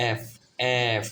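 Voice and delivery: "এফ" pronounced to show its closing f sound; letter-name demonstration. Shown as a demonstration. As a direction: say "এফ" slowly as "eff", letting the final f sound be heard. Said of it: "ফ এর একটা উচ্চারণ আছে".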